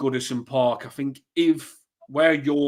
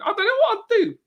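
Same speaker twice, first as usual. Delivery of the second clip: much higher wailing mock sob; mock crying. Mocking herself to the hosts, hammed-up crying wail. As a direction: whimper it like a hammed-up cry. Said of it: "she'll be laughing".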